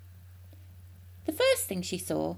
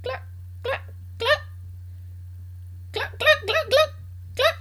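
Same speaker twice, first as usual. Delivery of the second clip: high, short, spaced clucks; hen clucking. A narrator's reading. Brown hen sat in the yard laying an egg, clucking. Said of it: "the big brown hen sat down in the middle of the yard and went".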